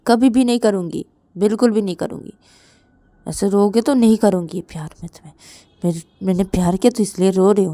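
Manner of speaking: loud, loving mother's telling-off through tears; acted upset, forceful and insistent; tearful scolding. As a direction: scold the child with emotion welling up. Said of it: "mummy भी रो देती और बोलती कि".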